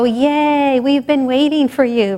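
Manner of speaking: bright, happy cheer up high; joyful welcome. Retold or imitated. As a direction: greet them like long-lost friends. Masters welcome the seeker.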